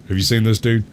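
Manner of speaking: normal talking voice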